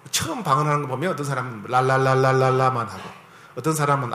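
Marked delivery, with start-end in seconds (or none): 1.7-2.9 babbling tongues. Retold or imitated. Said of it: "아이들 옹알이처럼 해요".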